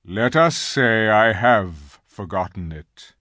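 slow, weighty lion's answer; grave. A narrator's reading. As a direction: answer gravely, taking your time. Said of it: "answered Aslan gravely".

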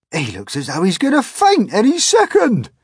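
very high, loud centipede. Acted. Centipede telling everyone James will faint, high and loud. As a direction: bray it out like a brash centipede.

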